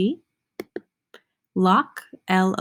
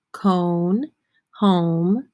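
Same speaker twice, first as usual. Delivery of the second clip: ordinary talk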